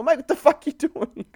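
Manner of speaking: high, baffled uncle; retold disbelief. Uncle shocked at his nephew, high disbelieving. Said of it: "I go".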